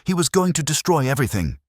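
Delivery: high, loud and fast; a raging high outburst; frantic furious cry. A narrator's reading. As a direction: rush it out in rage. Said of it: "she screamed".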